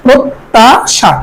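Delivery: very slow প্রত্যাশা, "prot - ta - sha"; doubled t. Shown as a demonstration. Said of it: "তদ্বিত্ত উচ্চারণ হবে". (প্রত্যাশা ভেঙে "প্রোত্-তা-শা")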